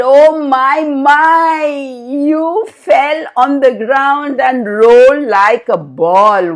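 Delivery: high, slow teasing voice; a big moon gloating over a fall; mocking moon. Moon teasing fallen Ganesha, high and slow mocking. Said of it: "burst out laughing".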